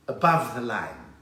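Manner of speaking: put-on slow, stretched southern suburbs Cape Town accent. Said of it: "those people who talk like that".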